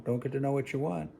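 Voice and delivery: quiet inner voice. Retold or imitated. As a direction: say it like a lesson whispered to yourself. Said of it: "the kid will learn".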